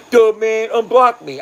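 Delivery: high, loud mocking "duh" voice; dopey viewer voice. Banned viewer begs the streamer in DMs, dopey high voice. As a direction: play a dim viewer begging to be unblocked. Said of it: "you'll be like, in my DM".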